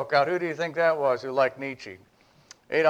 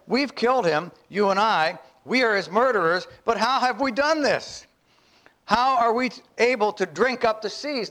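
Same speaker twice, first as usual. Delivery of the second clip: raised, urgent madman's lament; madman proclaiming; 8 semitones higher than usual. Acted. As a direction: accuse everyone, you and I. Madman's speech read again.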